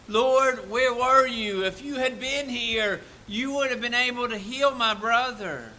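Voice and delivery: loud, high anguished plea; pleading cry. Acted. Grieving sister crying out to Jesus. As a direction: cry out to him in grief.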